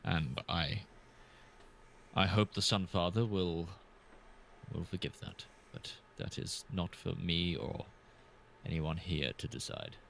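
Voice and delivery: low, slow solemn confession with heavy pauses; measured, penitent in-character speech; solemn player character. Acted. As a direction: speak gravely and haltingly, low in pitch.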